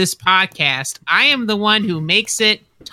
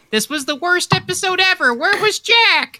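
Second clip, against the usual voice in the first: mock fan voice; 11 semitones higher than usual. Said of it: "your kind messages asking".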